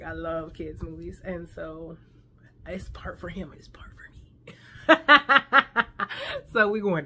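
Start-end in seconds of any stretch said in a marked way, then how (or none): 3.6-4.5 a sly unvoiced whisper; whispered aside. Real, not acted. Vlogger confiding to viewers she loves kids movies, playful whisper. 4.7-6.5 high, loud burst of giggling; giggle. Real, not acted. Vlogger laughing at her own kid-movie confession, giggling.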